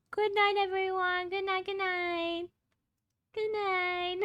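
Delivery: sleepy high singsong goodbye; high singsong good night. Real, not acted. Singsong goodnight, falling asleep.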